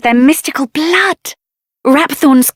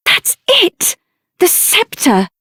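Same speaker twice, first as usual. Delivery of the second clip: high, sharp gasp of discovery; sudden realization; 6 semitones higher, slower than usual. Acted. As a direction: it just clicked, blurt it out. Sudden realization about the scepter.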